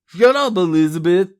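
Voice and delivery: very loud, forceful bark; shouted order. A narrator's reading. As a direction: cut her off with a shout. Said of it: "Charlie ordered".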